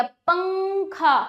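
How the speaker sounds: पंखा with a long nasal ng, mouth barely open; nasal anusvara. Shown as a demonstration. Teacher proving the mouth stays half closed, nasal पंखा. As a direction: hold the ng in पंखा through the nose. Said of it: "मुख पूरा नहीं खुला फिर भी उच्चारण हो गया".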